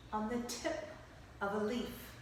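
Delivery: soft and small on the tip of a leaf; quiet storybook hush. Acted. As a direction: drop to a hush for the tiny leaf. Storyteller shrinks to tiny leaf.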